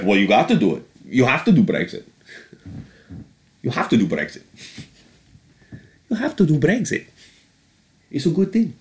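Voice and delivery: lightly voiced Putin pushing Brexit; imitating Putin. Comic voicing Putin urging Britain to do Brexit, hammed-up Russian lilt. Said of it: "he's like".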